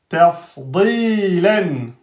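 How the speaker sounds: Tafdilan drawn out slowly; careful teacher recitation; slow model recitation. Shown as a demonstration. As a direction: recite Tafdilan slowly, every letter clear. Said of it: "model recitation of word".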